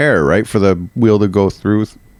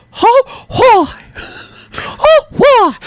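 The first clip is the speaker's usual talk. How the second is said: very high falsetto cartoon whoop; high squeaky mascot voice; Mickey Mouse; 3 dB louder, much slower than usual. Shown as a demonstration. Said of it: "I'm Mickey Mouse".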